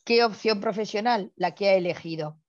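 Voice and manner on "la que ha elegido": ordinary delivery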